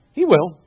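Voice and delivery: "He will" high and very loud, then falling; emphatic HE WILL. Shown as a demonstration. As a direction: land "He will" as a forceful certainty. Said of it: "there's the emphasis on he will".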